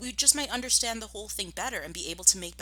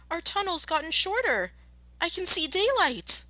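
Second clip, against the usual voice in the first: put-on much higher, excited child's voice; a cub spotting daylight; small fox; 12 semitones higher, 6 dB louder than usual. Small fox alarmed in story.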